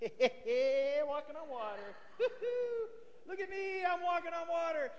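very high, soft giggly singsong; tiny high voice, showing off with giggles; giddy glee. Acted. Peter gleeful walking on water.

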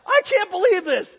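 loud, high, slow fake dismay; hammed-up shocked cry. Son acting shocked at the burnt field for his father, hammed-up cry. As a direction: wail it as a playacted innocent. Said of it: "I look out and I go".

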